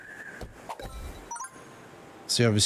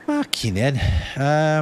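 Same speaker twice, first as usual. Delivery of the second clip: slow, high, louder stunned curse; 7 semitones higher, 5 dB louder, much slower than usual. Real, not acted. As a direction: drag it out in disbelief. Reacting to game board.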